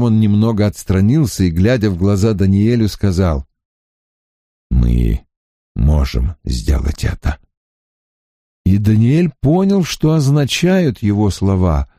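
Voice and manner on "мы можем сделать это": calm resolve. A narrator's reading.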